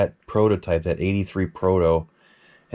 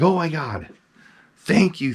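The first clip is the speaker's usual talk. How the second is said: overjoyed thanks; 8 semitones higher than usual. Retold or imitated. Collector thanking Frank for finally selling the mask, overjoyed and high. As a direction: gush with delight, voice much higher. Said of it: "I'm like".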